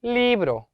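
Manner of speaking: 리브로 with 리 pushed high; stress on 리. Shown as a demonstration. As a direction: lift the first syllable of 리브로, let the rest fall. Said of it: "이에 강세가 들어가는 거죠". (리브로의 '리'를 높여 강조)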